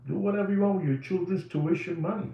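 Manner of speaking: ordinary talk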